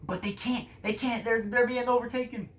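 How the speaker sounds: game master hyping the ambush; excited rising narration. A narrator's reading. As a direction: build the tension up fast. Climax of ambush.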